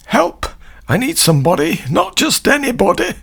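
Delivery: high-pitched desperate cry for help; mock scream. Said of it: "We start immediately with screaming. Not singing, screaming".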